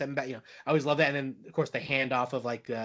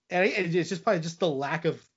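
usual speaking voice

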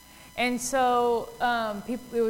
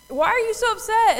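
high, loud incredulous question; mock scolding, hand on hip; acted exasperation; 11 semitones higher, 7 dB louder than usual. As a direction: challenge them as if baffled by the fuss. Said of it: "Jesus with his hand on his hip".

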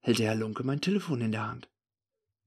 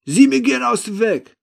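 high, shouted order; commanding; 12 semitones higher, 12 dB louder than usual. A narrator's reading. The man ordering the narrator aside, commanding. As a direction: bellow an order to clear the way. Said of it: "befiehlt mir".

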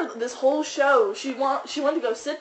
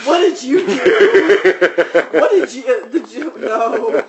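laughing mock question; 8 dB louder than usual. Voicing the exasperated child.

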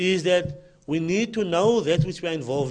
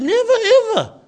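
mock emphatic quote; 13 semitones higher, 7 dB louder than usual. Mocking the lady's claim.

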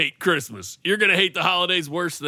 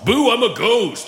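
slow, hollow spooky ghost; ghostly chest voice; 4 semitones higher than usual. Acted. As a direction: moan it like a haunting ghost. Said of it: "You don't like my ghostly chest voice".

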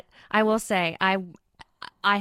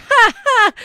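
loud high fake laugh in Southern accent; hammed-up ha ha; sarcastic acted laugh; forced stage laugh; 13 semitones higher, 9 dB louder than usual.

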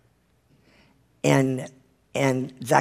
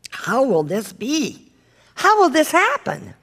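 Mary's astonished question; 8 semitones higher than usual. Acted. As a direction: ask it high, in wonder. Voices Mary questioning the angel.

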